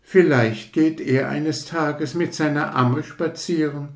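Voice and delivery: a lightly voiced conceited rocket, touchy and proud; hint of a fussy aristocrat taking offence; vain rocket. Rocket lectures the fireworks about itself, mildly haughty.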